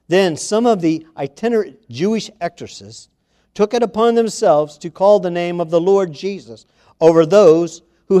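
preacher reading Acts to the church; scripture reading. Acted. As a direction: read the passage with rising force. Reads Acts passage aloud.

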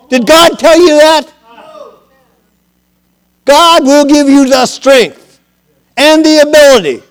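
high, loud pulpit exhortation; full-force preaching. Real, not acted.

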